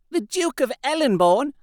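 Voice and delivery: startled by the name; exclaimed. A narrator's reading. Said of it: "Ursula exclaimed".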